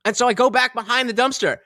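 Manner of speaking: usual speaking voice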